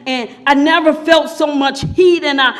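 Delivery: ordinary talk, nothing marked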